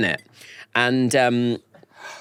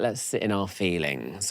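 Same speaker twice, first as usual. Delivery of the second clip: much softer, sitting in feelings; soft mopey voice; 4 semitones lower, 7 dB quieter than usual. Retold or imitated. Imitating the [name] camp, soft and mopey. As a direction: say it quietly and mopey. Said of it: "[name]'s more like".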